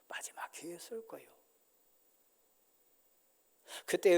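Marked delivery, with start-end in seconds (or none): 0.1-1.3 soft whispered aside. Acted. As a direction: whisper it, almost to yourself. (속삭이며)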